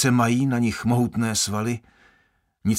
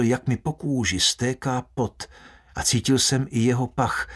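usual speaking voice

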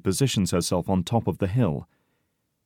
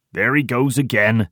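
louder, higher, angry complaint; furious; 4 semitones higher, 5 dB louder than usual. A narrator's reading. Tiny complains to Porter about Heidi, loud anger. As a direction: burst out in anger. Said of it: "says Tiny furiously".

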